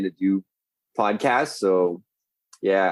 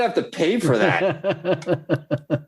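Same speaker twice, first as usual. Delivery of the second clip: laughing; 6 semitones higher, much slower than usual. Real, not acted. Laughs at cohost's joke.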